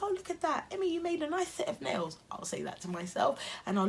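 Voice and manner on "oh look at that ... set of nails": high, delighted self-praise; high excited gushing at herself. Retold or imitated. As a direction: gush over your own nails. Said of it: "I'm like".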